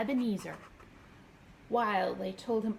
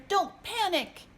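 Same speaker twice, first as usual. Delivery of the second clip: high and louder, urgent; cried out; 11 semitones higher, 5 dB louder than usual. A narrator's reading. Hector calls to his friends as the boat sinks, high loud cry. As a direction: shout it over the panic. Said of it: "cried Hector".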